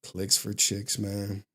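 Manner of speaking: low, soft mock confession; a hint of a glum, low mumbler; imitating a downbeat viewer. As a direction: lightly mimic a guy who is down bad. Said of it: "talking like this to me".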